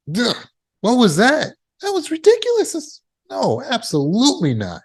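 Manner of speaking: high-pitched incredulous scoff, falling to firm refusal; appalled dismissal. Shown as a demonstration. Speaker showing mothers how to dismiss a bad thought, appalled. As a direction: recoil with a grunt, then mock-outraged disbelief. Shows the right response to a thought.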